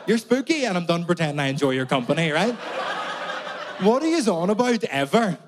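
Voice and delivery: loud exasperated rant. Real, not acted. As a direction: go loud and fed up. Ranting at English people.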